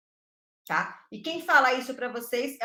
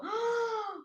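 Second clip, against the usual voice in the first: a high, airy gasp of amazement; high gasp of wonder; 11 semitones higher, 4 dB quieter than usual. Acted. Teacher to a class, a high gasp of wonder. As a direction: gasp like a child discovering something.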